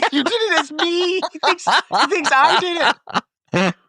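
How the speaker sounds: very high, squeaky outrage; mortified protest. Retold or imitated. Brother protests being impersonated to the diver, mortified. Acted quarrel with his brother.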